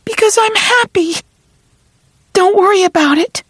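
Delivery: tearful. Acted. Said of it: "You're crying".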